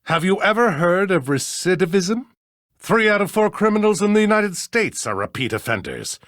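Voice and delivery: indignant retort. Acted. Judge retorts to demand for evidence.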